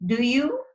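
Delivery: "do you" sweeping up on "you"; rising question tag. Shown as a demonstration. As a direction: make "do you" a real question, rising at the end. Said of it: "notice in these three sentences how my voice rose".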